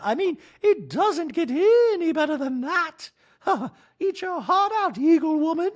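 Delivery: Dorit impression. Wife nagging husband about salad, high mock voice. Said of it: "Dorit's talking to herself".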